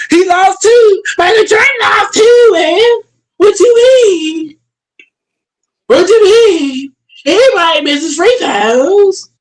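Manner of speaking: very high, whiny mock accent; exaggerated accent, drawn-out questions; crying Giannis impression. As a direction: wail it like a big baby at the podium. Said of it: "I can't even do his accent".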